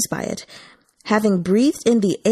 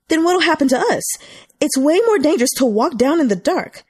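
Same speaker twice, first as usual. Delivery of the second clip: angry crowd; 7 semitones higher than usual. A narrator's reading. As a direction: demand answers from staff. Angry visitors raise hell.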